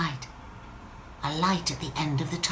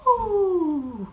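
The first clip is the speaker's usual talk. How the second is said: high wailing ooh; a little cry of alarm; frightened ooh; 11 semitones higher than usual. Acted. Drop going over the waterfall, a high frightened ooh.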